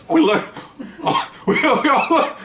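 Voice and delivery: high-pitched words broken up by laughter; laughing. Real, not acted. Detectives all look after the bird swears, laughing. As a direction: crack up while trying to talk. Teller cracks up at parrot's reply.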